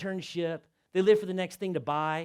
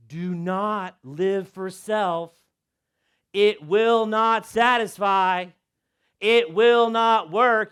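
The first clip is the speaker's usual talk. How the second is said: slow, louder, hammered words; slow emphatic proclamation; 8 dB louder, much slower than usual. Shown as a demonstration. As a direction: proclaim it to the room. Repeated loud and slow.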